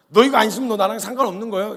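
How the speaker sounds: loud, high, exasperated threat; annoyed. Acted. Jesus warning Peter who refuses washing, annoyed. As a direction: snap it out high and loud, fed up. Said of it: "짜증나셨어요".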